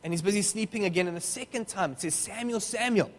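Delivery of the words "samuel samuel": higher-pitched call of a name. Acted. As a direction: lightly call out to a sleeper. Voice calls sleeping boy second time.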